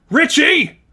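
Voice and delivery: high, loud accusing yell; shout. Acted. Jill accusing Richie, loud angry shout. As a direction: call him out. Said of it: "Jill shouted".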